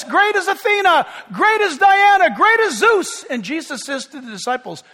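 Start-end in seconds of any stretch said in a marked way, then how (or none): 0.0-3.2 loud, high chanted cries; crowd shout. Retold or imitated. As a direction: bellow each god's name like the crowd. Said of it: "they're all shouting".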